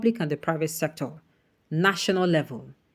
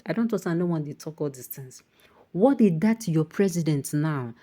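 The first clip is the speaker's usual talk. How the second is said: normal talking voice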